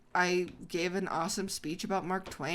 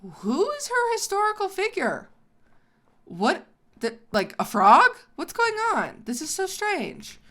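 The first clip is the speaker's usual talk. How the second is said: much higher, incredulous disbelief; baffled inner voice; 11 semitones higher, 5 dB louder than usual. Retold or imitated. Narrator recalling confusion at rival's speech, high baffled voice. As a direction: play your confused past self hearing a frog story. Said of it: "And I'm going".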